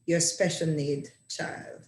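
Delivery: ordinary talk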